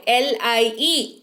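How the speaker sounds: slow, letter by letter spelling; each letter of पी एल ए आई एन said slowly and separately. Shown as a demonstration. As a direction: spell it out like on a board. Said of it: "spelling the word out".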